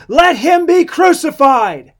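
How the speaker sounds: slow, high, very loud shout; drawn-out mob yell; crying out. A narrator's reading. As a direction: bellow it as a whole crowd demanding death. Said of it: "they all cried out again".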